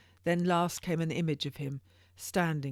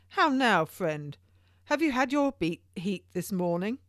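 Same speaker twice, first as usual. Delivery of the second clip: a teasing voice, higher and louder; mocking barrister; 5 semitones higher, 6 dB louder than usual. A narrator's reading. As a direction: taunt the convict about his whipping.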